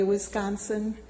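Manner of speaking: normal talking voice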